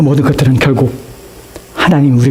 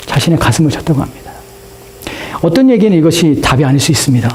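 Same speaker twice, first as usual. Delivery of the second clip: ordinary talk, nothing marked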